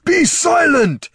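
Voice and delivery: high, loud barked shout. A narrator's reading. Chieftain shouting his sister down. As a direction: cut her off with a shout. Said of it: "shouted Darek".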